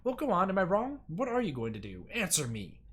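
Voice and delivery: loud demand to answer; a secretary berating a visitor. Acted. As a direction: press her hard, then bark. Woman keeps shouting at her.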